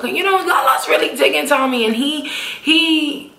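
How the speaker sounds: ordinary talk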